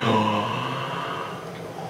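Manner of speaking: long, soft intake of breath; awestruck gasp. Retold or imitated. Acting out first sight of a date, stunned gasp. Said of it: "I did exactly the same thing, like".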